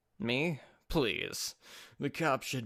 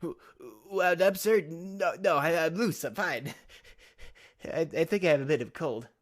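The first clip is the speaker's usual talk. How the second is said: nervous Apollo; 5 semitones higher, 6 dB louder than usual. Acted. Apollo denies being nervous.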